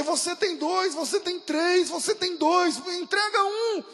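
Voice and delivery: high, loud, pleading, slowing on the last words; desperate plea. Retold or imitated. Grieving mother pleading with the tribesmen, high loud cry. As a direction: throw it at them as an accusation.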